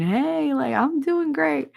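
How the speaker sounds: high-pitched put-on chirpy "doing great"; faking cheer. Woman shows viewers how faking it would sound, faking cheer. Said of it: "if I'm just faking it".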